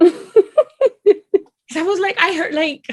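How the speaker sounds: laughing, slow and high; laughing while recalling crying. Real, not acted. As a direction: giggle through it. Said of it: "I cried in that scene".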